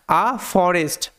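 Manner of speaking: "a forest" drawn out word by word; slow careful reading. Shown as a demonstration. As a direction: stretch it out for learners. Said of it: "আস্তে আস্তে ভেঙে ভেঙে উচ্চারণ".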